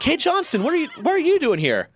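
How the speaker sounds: high mock voice of a nosy boss; mock Chief voice. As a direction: lampoon the boss in a high cartoonish pitch. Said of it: "And he's like".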